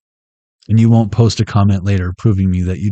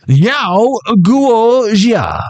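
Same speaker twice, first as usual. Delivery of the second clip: high, drawn-out angry mock-Chinese cry; 10 semitones higher, much slower than usual. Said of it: "in an angry Chinese voice".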